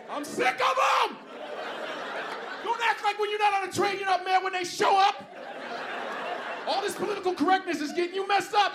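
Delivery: high, shouted, fast ranting; fed up with subway panhandlers, shouting a rant. Real, not acted. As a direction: let the rant explode. Shouted rant about homeless.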